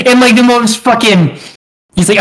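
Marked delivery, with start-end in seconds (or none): none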